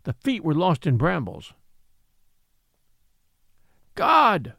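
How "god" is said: high, wild cry with worship in it; frightened cry. A narrator's reading. Worshipper seeing the great figure rise, crying in fear. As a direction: cry out at the sight of a god. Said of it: "cried a wild, frightened voice".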